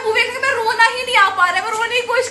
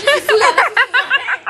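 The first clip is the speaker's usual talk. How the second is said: louder, whiny urgent plea; whiny kid voice; 4 semitones higher, 6 dB louder than usual. Retold or imitated. Friend mimicking Sakshi begging for the loo, whiny. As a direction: beg like a child who needs the loo. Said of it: "फिर साक्षी ने बोला".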